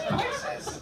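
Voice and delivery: high squeaky disbelief; can't believe it, laughing; incredulous. Real, not acted. Comic mocking the textbook, high incredulous squeak.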